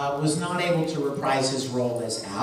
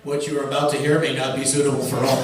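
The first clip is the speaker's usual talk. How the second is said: mock announcer; 5 semitones lower, faster than usual. Mock content warning.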